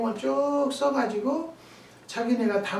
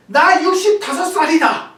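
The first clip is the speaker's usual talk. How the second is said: loud, higher, put-on gruff old man's voice; rude blunt answer; 8 semitones higher, 12 dB louder than usual. Customer loudly stating his age to the farmer. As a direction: bark out the age like an arrogant old man.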